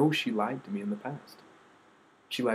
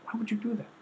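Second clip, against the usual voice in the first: much higher pitch, with an incredulous question; outraged disbelief; 12 semitones higher, faster than usual. Real, not acted. Outraged at being lied to.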